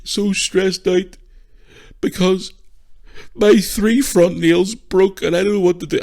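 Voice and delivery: slow, high-pitched whinging sob; hammed-up tearful whine; mock crying. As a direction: imitate a girl boo-hooing over nothing. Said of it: "Crying and boo-hooing and whinging".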